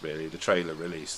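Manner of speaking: ordinary talk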